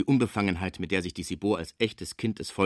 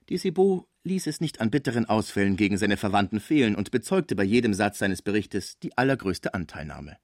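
ordinary delivery, nothing marked

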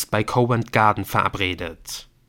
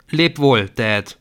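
louder, emotional goodbye; son's farewell; 3 semitones higher, 6 dB louder, slower than usual. Acted. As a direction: say goodbye to his father. Son bids farewell. (bewegter Abschied des Sohnes)

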